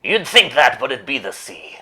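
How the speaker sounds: excited punchline. Acted. Joke punchline delivery.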